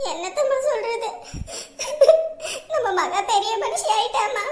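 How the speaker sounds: sobbing between phrases; crying daughter on the phone; tearful. Acted. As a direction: break the news through sobs. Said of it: "ஏன் அழுகுற?".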